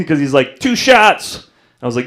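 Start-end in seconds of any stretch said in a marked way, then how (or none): 0.6-1.5 high, projected mock ref call; referee's call. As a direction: announce the penalty sharply. Referee calls the penalty.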